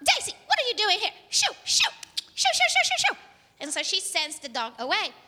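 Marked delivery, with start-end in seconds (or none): 0.1-3.2 high, loud, rapid-fire scolding; shooing the dog. Acted. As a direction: play a mom chasing off a dog. Mom chases the dog away.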